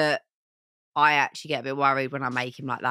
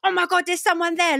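imitating Liam's panic; 14 semitones higher, 5 dB louder than usual. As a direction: ham up a jumpy passenger, pitched high. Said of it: "He's like".

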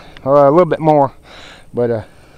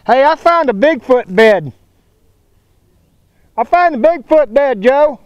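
calling out; 8 semitones higher, 5 dB louder than usual. Real, not acted. Calling to Joe in woods.

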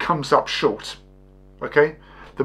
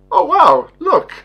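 high, loud burst of mock amazement; excited gasp; 13 semitones higher, 6 dB louder than usual. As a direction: play up sudden surprise at spotting him. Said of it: "was like".

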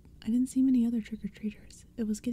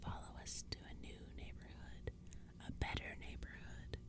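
hissing whisper; 17 dB quieter than usual. A narrator's reading. Pumpkinhead ordering the kids to follow, hissing in a whisper. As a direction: whisper it as an eerie summons. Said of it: "one of the Pumpkinheads hissed".

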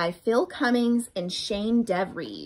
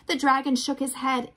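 plain reading voice

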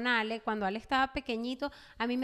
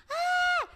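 very high scream; retold shriek of horror; grito de susto. Retells reaction to seeing blood. (chillido agudo de susto)

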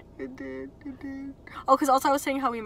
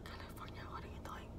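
hushed whisper; 24 dB quieter than usual. Real, not acted. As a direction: whisper it to yourself. Whispered aside.

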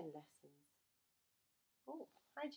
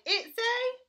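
high, loud panicked cry; the bees cry out in terror; frightened cry; 12 semitones higher, 8 dB louder, much slower than usual. Acted. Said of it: "they cried".

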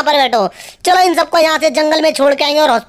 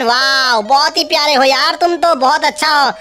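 ordinary talk, nothing marked

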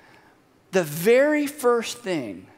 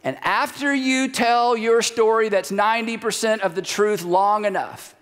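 usual speaking voice

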